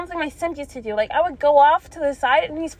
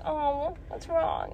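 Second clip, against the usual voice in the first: mock sympathy; 3 semitones higher, 8 dB quieter, much slower than usual. Voicing what the crying man wanted to hear, softly sympathetic. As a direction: imitate the gentle comforting he wanted. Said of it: "wanting me to be like".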